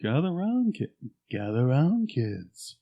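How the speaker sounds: slow, drawn-out pitchman voice; rehearsing a commercial announcer's call; Silver Shamrock announcer. Acted. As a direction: stretch it out, beckoning the kids. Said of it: "as the Silver Shamrock guy".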